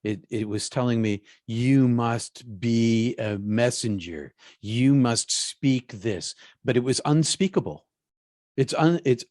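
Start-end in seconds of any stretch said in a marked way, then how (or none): 1.5-6.4 very slow, weighty command; measured, word-by-word pronouncement; commanding inner voice. Retold or imitated. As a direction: deliver it as a solemn order from within. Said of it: "this roar was speaking my name".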